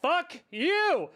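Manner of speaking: high triumphant yell at opponent; excited shout. Real, not acted. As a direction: yell it out in victory. Big win, yelling at opponent.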